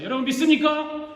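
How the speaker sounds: loud, high preacher's question; revival-style shout to the crowd; rallying call. Shown as a demonstration. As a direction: rouse the congregation to answer. Repeats the call to congregation.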